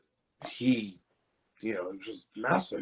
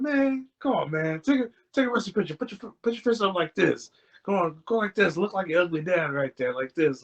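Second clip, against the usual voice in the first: high, lively mock coaching; pitched-up retold urging; imitated bossy uncle; 8 semitones higher than usual. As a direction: imitate an uncle hurrying a kid into a pose. Uncle coaxing kid into photo pose.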